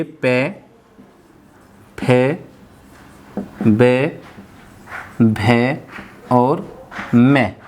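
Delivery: "पे, फे, बे, भे और मैं" spaced with long pauses; slow lip-sound drill. Shown as a demonstration. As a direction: recite "पे फे बे भे मैं" for the class to repeat. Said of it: "बोलते रहिए साथ साथ और नोट भी करिए".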